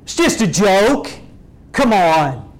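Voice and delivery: loud, brushing-off mock voice; dismissive joker. Mocking someone who excuses a cruel joke, dismissive. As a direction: shrug it off, mockingly. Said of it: "And then go".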